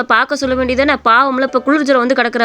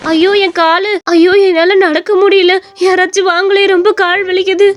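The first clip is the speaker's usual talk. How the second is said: higher, louder cries of pain; crying in pain; 7 semitones higher, 4 dB louder than usual. Acted. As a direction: wail for help after a fall. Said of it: "எதுக்கு இப்படி அழுதுகிட்டு இருக்கிற?". (வலியால் உரக்க அழுகை)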